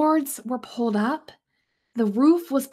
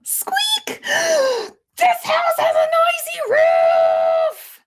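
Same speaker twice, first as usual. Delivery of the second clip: high, loud, slow shout; frustrated yell; 7 dB louder, slower than usual. Acted. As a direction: yell it out at the roof. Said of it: "yelled Owl".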